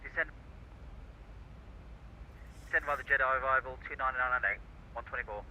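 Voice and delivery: quick radio readback; fast pilot readback over radio; air traffic control radio. Real, not acted.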